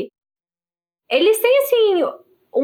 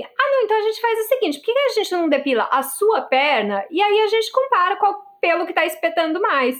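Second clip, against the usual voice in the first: indignant; 7 semitones higher than usual. Retold or imitated. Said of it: "eu fiquei tão indignada".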